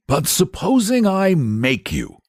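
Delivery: Saint George, high and a little irritated; a knight's slightly nettled challenge. A narrator's reading. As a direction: hero voice, mildly annoyed. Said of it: "rather nettled".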